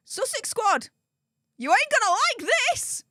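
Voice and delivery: high, sing-song taunt; gleeful taunt. Real, not acted. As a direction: tease them in a high, gloating lilt. Taunting the squad before revealing.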